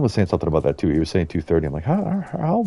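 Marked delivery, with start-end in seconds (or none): 1.8-2.6 high-pitched mock disbelief; acted-out incredulous stammer; baffled quote. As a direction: imitate your own puzzled reaction, pitched way up. Retells own baffled reaction.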